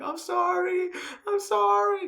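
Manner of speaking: very high squeaky apology; mock pleading. Film fan acting out Shoko being beaten, pleading high. Voices victim apologizing during beating.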